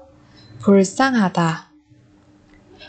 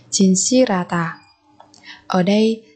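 normal talking voice

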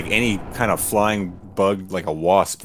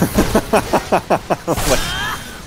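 breathy laugh; 8 semitones higher than usual. Real, not acted. Laughing at own spider joke.

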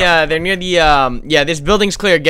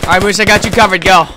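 shouting; 4 semitones higher, 7 dB louder than usual. Real, not acted. Covering teammate.

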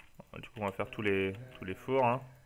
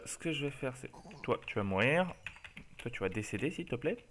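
mock polite; 11 dB quieter than usual. Real, not acted. Softly killing mobs, polite.

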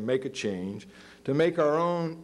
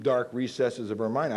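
ordinary talk

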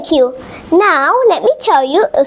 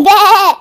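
louder, wavering imitated baa; sheep bleat; 4 semitones higher, 5 dB louder than usual. Cat who sounded like a sheep, bleating. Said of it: "who sounded like a sheep".

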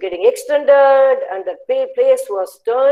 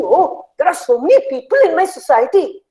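higher-pitched, animated delight; excited discovery; 5 semitones higher than usual. Acted. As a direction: exclaim it in happy surprise. Colours discover their neighbours.

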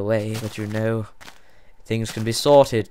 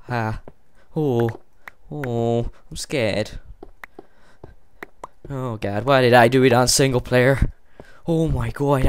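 usual speaking voice

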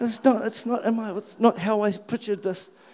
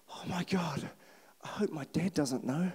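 hushed worry; 7 dB quieter than usual. Retold or imitated. Man waking in hospital worries about dad, hushed. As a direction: think it aloud, very softly. Retelling his thought on waking up.

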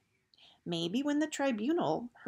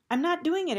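higher, louder flat refusal; 10 semitones higher, 7 dB louder than usual. Acted. Gregor refusing to let Eris take the blame, loud. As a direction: put your foot down hard.

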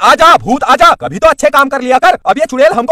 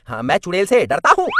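ordinary talk, nothing marked

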